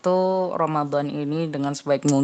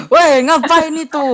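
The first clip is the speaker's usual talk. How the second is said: higher, louder, slow telling off; scolding; 9 semitones higher, 5 dB louder than usual. Retold or imitated. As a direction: scold the kid eating while fasting. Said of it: "satu rumah marahin aku".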